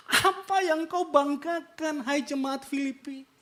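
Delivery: loud, high sermon delivery; preacher thundering at the congregation; fiery preaching. Acted. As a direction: drive it home to the congregation. Quoting Paulus in full preach.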